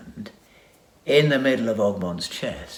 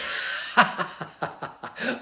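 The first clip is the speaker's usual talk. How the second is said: villain laugh; 14 semitones higher than usual. A narrator's reading. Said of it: "grabbed him by the neck and laughed".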